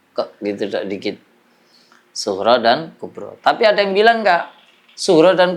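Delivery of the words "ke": ordinary talk, nothing marked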